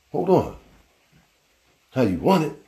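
jolted into a suspicious hold on; startled double take. Retold or imitated. Caught off guard by his question, startled and suspicious. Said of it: "So I was like".

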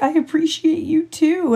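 high, whiny put-on voice of a teammate about to cry; mock crying teammate. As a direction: whimper it out like a grown man pretending to bawl. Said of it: "He's like, he's like".

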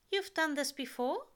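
a worried angel checking; anxious angel voice. A narrator's reading. Aziraphale, anxious question.

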